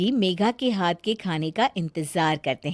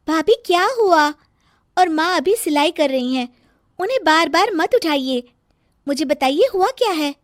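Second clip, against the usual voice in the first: put-on higher, lightly raised polite girl's voice; calm her bossy sister-in-law down; patient younger sister asking what's wrong; 7 semitones higher, 4 dB louder than usual.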